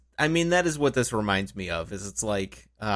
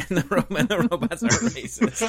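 much higher, laughing through words; laughing echo; 10 semitones higher than usual. Retold or imitated. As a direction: echo it while cracking up.

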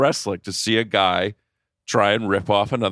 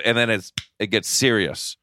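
normal talking voice

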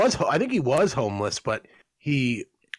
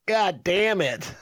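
put-on high, slow, drawn-out toddler curse; toddler parroting; 10 semitones higher, much slower than usual. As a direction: mimic a little kid repeating a swear. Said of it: "he went".

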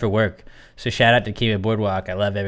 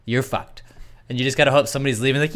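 ordinary talk

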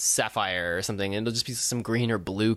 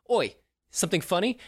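a bad fake Australian accent, pitched higher; hammed-up Australian accent, clearly put on; terrible fake Australian; 8 semitones higher than usual. Designer snapping at a laughing assistant, bad fake Australian accent. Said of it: "he's got a terrible fake Australian accent".

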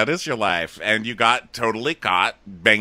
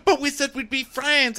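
very high whiny imitation of him; mock pleading. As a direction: protest in a squeaky, wounded voice. Mocking his pleading.

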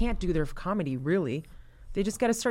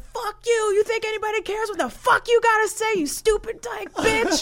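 mock rant; 3 dB louder than usual. Comic retold firing back at a heckler, high and loud. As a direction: tear into her like the comic did. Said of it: "He's like".

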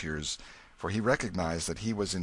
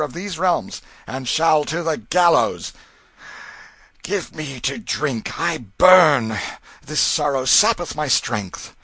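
loud, forceful royal proclamation; commanding king; 9 semitones higher, 10 dB louder than usual. A narrator's reading. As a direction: decree it with royal energy. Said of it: "went on with energy".

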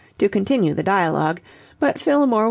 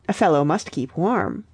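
usual speaking voice

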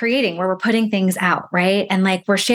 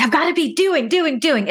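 higher-pitched, insistent mock self-talk; mock hustle urgency; 7 semitones higher than usual. Coach mimics someone hyper creating, urgent mock hustle. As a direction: imitate someone driven to keep busy.

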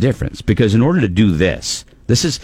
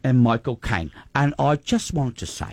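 quiet, laughing Michael Caine British impression; Michael Caine British accent, half laughing; 3 semitones higher, 6 dB quieter than usual. Shown as a demonstration. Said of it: "I'll be like".

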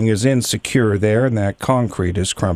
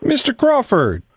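cheery greeting; 11 semitones higher than usual. Acted. Cheerful prank call greeting.

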